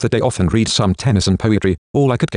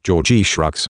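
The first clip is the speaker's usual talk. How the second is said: usual speaking voice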